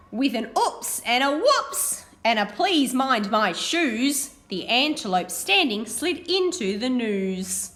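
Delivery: animated picture-book reading; reading to children. Acted. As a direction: read it brightly to a class of children. Teacher reads picture book aloud.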